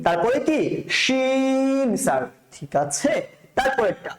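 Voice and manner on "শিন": শিন very slow, with full "sh"; thick shin. Shown as a demonstration. Teacher recaps letters with students, slow thick শিন. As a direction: stretch শিন, mouth full of sound. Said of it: "মোটা করে".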